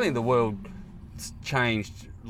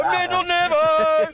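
high, slow singsong mockery; hammed-up taunt; 6 dB louder, slower than usual. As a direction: drag it out like a teasing schoolkid.

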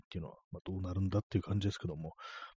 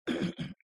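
a quick throat clear. Real, not acted. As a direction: clear your throat before talking. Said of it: "いきなり咳払いしてします".

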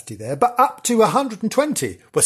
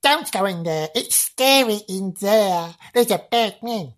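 put-on young girl's voice; 6 semitones higher than usual. Shown as a demonstration. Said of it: "just like that".

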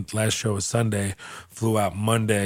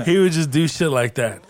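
ordinary talk

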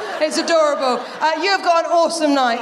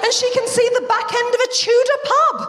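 squeaky outrage at a weird word; incredulous; 10 semitones higher than usual. Real, not acted. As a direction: pitch it way up in disbelief. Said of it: "comic outrage at the word".

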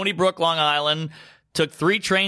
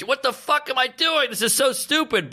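exasperated inner voice; 10 semitones higher than usual. Retold or imitated.

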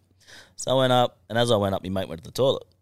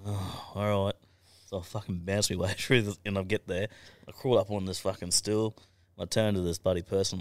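ordinary delivery

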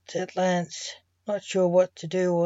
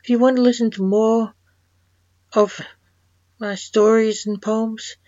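louder, upbeat outro announcement; podcast sign-off; 6 dB louder than usual. Real, not acted. Host invites listeners back for more stories, podcast sign-off.

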